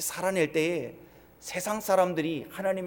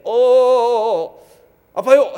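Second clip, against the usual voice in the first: stretched, much louder pained cries breaking into a scream; patient screaming; 6 semitones higher, 16 dB louder, much slower than usual. Retold or imitated. Patient on the operating table, screaming in pain at the doctor. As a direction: howl like a patient under the scalpel without anesthesia. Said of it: "이렇게 소리를 지르면".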